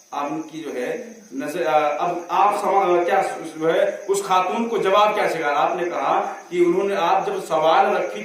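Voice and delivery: ordinary talk, nothing marked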